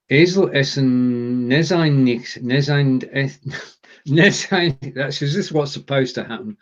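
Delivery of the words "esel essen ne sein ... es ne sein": halting German tongue twister, breaking off; stumbling attempt. Real, not acted. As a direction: stumble slowly over unfamiliar words.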